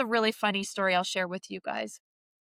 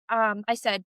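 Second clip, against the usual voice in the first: usual speaking voice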